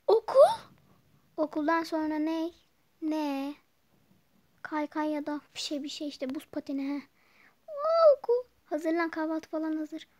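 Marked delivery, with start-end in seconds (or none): 0.1-0.5 a sudden high, loud cry of "okul?"; jolted awake by the word school; surprised. Acted. Daughter startled when mother mentions school, surprised cry. 7.7-8.5 a high, loud gasp of realisation; as if school just hit her; shocked. Acted. Daughter suddenly remembering school at breakfast, loud shocked gasp.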